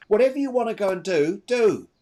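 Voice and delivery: hint of an urging mother; a touch of a mother pushing her on; quoting the mother. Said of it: "She says here".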